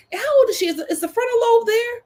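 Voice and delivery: squeaky incredulous question. Real, not acted. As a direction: ask in shocked disbelief. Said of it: "incredulous questions".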